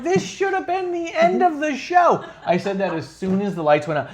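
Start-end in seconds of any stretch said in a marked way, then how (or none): 0.0-2.2 high-pitched, slow, emphatic exclamation; retold exclamation. Podcaster reliving the show's ending, exclaiming. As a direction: draw it out high in mock outrage.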